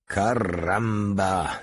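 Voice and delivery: long, drawn-out gleeful exclamation; amused delight. A narrator's reading. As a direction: draw it right out with relish. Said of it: "zaśmiał się z wielką przyjemnością". (przeciągle, z rozbawieniem)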